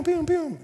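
high-pitched "pew" noises; searching zip noises. Retold or imitated. Dad mimics darting around looking for daughter, high zippy noises. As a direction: imitate zipping about with quick high sounds.

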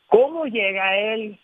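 put-on timid teen. Shown as a demonstration. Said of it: "Llega en un estado de ánimo hasta temeroso".

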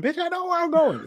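high-pitched mock outrage; indignant dad comeback. Father snapping back in story.

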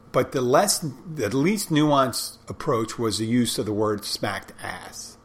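ordinary talk, nothing marked

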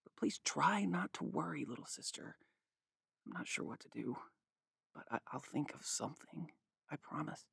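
calm his little sister down gently; softly reassuring boy. Acted. Hansel comforts sister softly.

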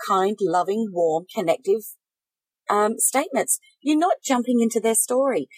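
ordinary talk, nothing marked

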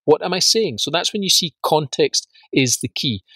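ordinary talk, nothing marked

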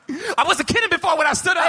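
high, laughing mock challenge. To cohosts, joking about a fight over the movie, laughing mock challenge. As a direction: joke the threat through a laugh.

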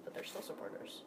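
whispered aside. Real, not acted. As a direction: drop to a whisper.

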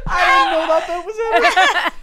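loud, high, slow words through laughter; helpless laughter. Real, not acted. Actor surprised by own audition tape, laughing helplessly. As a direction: squeal it out while cracking up.

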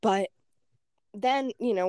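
ordinary talk, nothing marked

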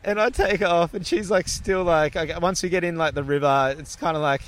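ordinary talk

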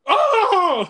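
loud, high-pitched mock howl; mock pain scream. Joe cutting his hand on a razor, mock scream. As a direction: imitate a sudden scream of pain.

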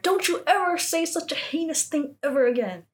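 high-pitched mock scolding; pissed off. Grabbing a girl by her collar, mock pissed-off warning. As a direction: imitate an indignant warning without shouting. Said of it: "Yoon-hee is pissed".

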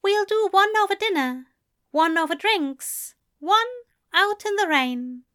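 eager. A narrator's reading. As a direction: list them with delight. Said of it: "warm and adoring".

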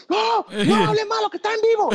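regaño imitado en falsete; Pichín alarmado. Retold or imitated. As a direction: corta al locutor con una advertencia agudísima. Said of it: "y Pichín dice". (productor alarmado porque están en vivo)

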